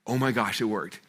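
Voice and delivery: low, hushed disbelief; retold quiet amazement; stunned realization. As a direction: let it sink in quietly. Acts out stunned realization at inbox.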